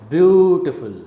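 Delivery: "beautiful" with a strong first syllable, then falling; falling rhythm. Shown as a demonstration. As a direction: go down from a stressed start in "beautiful". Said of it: "you go like this".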